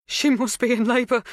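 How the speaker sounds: high, upset voice; wobbling. A narrator's reading. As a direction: say it close to tears. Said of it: "her mouth wobbling around the words".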